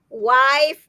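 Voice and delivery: usual speaking voice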